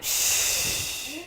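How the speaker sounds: soft whispered shh of water spraying; spray sound. Shown as a demonstration. Mom shows the elephant spraying water, a soft shh. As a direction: hiss out the trunk's spray. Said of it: "and then spray it out".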